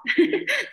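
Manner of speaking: a quick high burst of laughter. Real, not acted. To her listeners, laughing at her impatience. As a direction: laugh at yourself.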